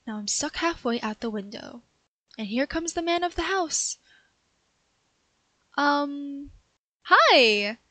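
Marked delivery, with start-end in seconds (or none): 5.8-7.8 a loud, startled, too-bright greeting; awkward hello. Acted. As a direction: caught and trying to brush it off. Caught by the homeowner.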